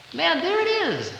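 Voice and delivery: high, amazed gasp of discovery; suddenly see it all in the hymnal; awed realization. Retold or imitated. High churchgoer opening the hymnal, struck with awe.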